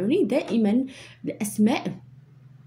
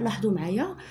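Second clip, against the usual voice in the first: ordinary talk, nothing marked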